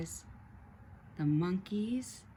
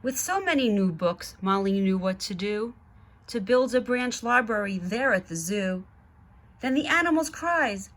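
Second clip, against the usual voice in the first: put-on lively read-aloud to children; story narration; 3 semitones higher, 9 dB louder than usual. A narrator's reading. Reading the Zoobrary book to kids, lively narration. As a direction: read it like a bedtime picture book.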